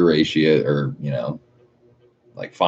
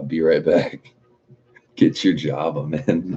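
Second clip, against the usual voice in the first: usual speaking voice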